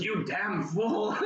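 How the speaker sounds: a hint of Flitwick with a laugh in it; imitating angry Flitwick. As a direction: play the stabbed professor yelling in mock outrage. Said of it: "Flitwick looks at him and goes".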